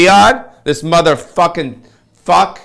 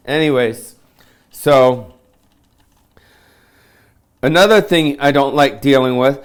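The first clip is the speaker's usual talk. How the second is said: ordinary talk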